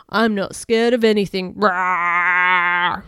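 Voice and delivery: loud, show-off declaration; boastful roar. Acted. Bill showing off as Gigantosaurus, loud boast. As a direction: boast loudly like a big dinosaur. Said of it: "roared Bill, showing off".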